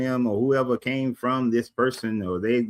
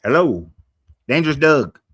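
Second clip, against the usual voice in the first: calling out; 5 semitones higher, 7 dB louder than usual. Real, not acted. Calling out to a chatter.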